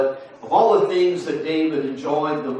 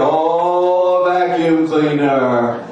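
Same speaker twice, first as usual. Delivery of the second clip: slow, louder, let-down repeat; unimpressed wife; 2 semitones higher, 5 dB louder, much slower than usual. Retold or imitated. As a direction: drag out the words like a disappointed wife.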